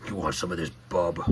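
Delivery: low, gruff tough guy; Wolverine voice. Pretend. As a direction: growl it as a tough brawler. Voicing the Wolverine figure.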